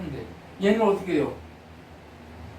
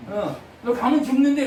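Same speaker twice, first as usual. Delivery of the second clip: louder and higher, stressing the doom; emphatic prophet warning; 6 semitones higher, 6 dB louder than usual. Shown as a demonstration. Prophet warning the king, said louder and higher. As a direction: hammer home that he will die. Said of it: "이렇게 얘기한단 말이에요".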